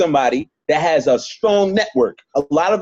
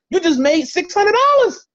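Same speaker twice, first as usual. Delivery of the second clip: excited; 13 semitones higher than usual. Real, not acted. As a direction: celebrate the big payout. Excited money payoff.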